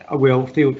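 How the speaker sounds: ordinary talk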